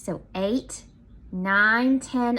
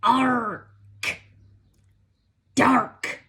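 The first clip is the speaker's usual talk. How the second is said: pirate voice; 5 dB louder than usual. Shown as a demonstration. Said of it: "you can even sound like a pirate when you say it".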